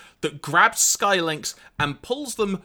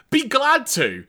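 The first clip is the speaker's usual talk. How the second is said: Blaster; 8 semitones higher, 4 dB louder, slower than usual. Retold or imitated. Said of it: "Blaster then says".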